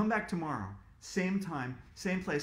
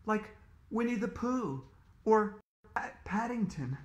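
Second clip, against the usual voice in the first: ordinary delivery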